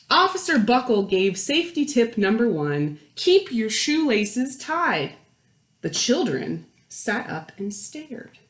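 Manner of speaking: put-on lively read-aloud voice for children; animated picture-book narrator; storybook narration. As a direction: read it like story time to a class.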